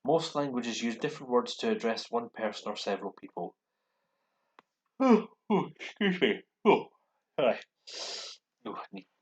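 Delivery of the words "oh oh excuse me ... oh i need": high, startled oh to someone arriving. Real, not acted. Teacher interrupted by someone walking in, startled. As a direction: caught off guard mid-lesson.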